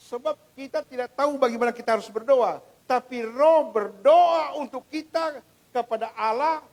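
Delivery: rising sermon climax, loud; full-force preaching. Acted.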